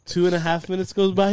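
normal talking voice

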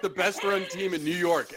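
ordinary talk, nothing marked

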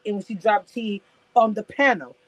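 ordinary talk

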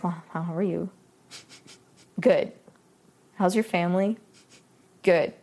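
low, quiet, awkward exchange; boring small talk. Retold or imitated. Woman retelling awkward small talk on a date, bored and subdued. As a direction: mimic stilted small talk, low and lifeless. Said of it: "really boring".

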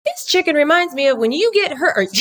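laughing through a high mock southern drawl; slow folksy southern drawl, cracking up. Wife mimicking husband's southern drawl, laughing. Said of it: "In his southern drawl".

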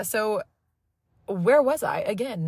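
ordinary talk

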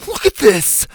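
high, loud, upset yell; distraught kid yelling; tearful yell. A narrator's reading. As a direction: yell as if near tears. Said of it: "Ash yelled, practically in tears".

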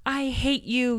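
high mock shout of I hate you; a mom voicing her angry daughter; imitating shouting child. Mom retelling her daughter's tantrum, high mock voice. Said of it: "she started shouting".

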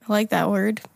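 ordinary talk, nothing marked

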